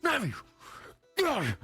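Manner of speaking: high-pitched, barked shout for the navigator; acted captain's yell. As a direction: holler impatiently at a crewmate. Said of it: "get back into high spirits. And he's like".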